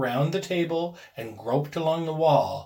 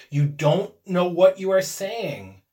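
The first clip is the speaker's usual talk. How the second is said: slow, raised, troubled voice; unsteady; 4 semitones higher, 6 dB louder, slower than usual. A narrator's reading. Husband tries to calm frantic wife, unsteady. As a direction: reason with her slowly and uneasily. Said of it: "he said unsteadily".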